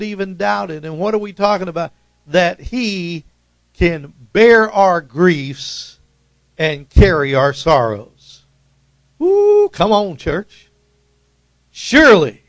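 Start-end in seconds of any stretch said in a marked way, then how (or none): 2.3-8.4 louder, slow preacher build; preaching fire. Acted. 9.2-9.7 high loud whoop; whooping up the church; preacher's woo. Real, not acted. Preacher's exclamation. 11.7-12.4 Surely flung out loud and high; preacher shouting Surely; proclaiming shout. Acted. Preacher shouting the key word.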